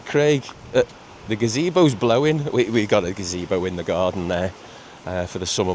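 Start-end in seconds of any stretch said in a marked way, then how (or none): 0.0-2.4 lightly voiced wife calling, a touch higher; a hint of his wife calling out from the conservatory; wife's little voice. Wife calling him from the conservatory, mildly worried. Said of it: "all I could hear was this little voice go".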